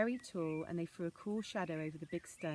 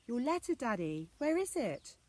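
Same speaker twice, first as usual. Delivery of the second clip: high, louder worried question; anxious exclaim; 6 semitones higher, 5 dB louder than usual. Acted. Anna asking father for the lost letter, alarmed. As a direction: ask in sudden alarm. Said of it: "she exclaimed".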